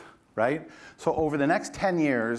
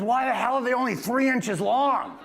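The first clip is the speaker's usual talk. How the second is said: angry boss; 10 semitones higher, 3 dB louder than usual. Retold or imitated. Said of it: "He says".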